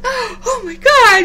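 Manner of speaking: high, slow, loud teary gush; gushing nurses. Retold or imitated. Nurses gushing about the news, high and teary. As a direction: overcome with delight for her. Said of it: "they were just like".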